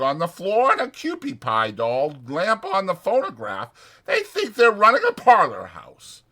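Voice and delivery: chatty ranch hand selling a night in town; Whit's voice. Acted. Whit retelling Susie's jokes.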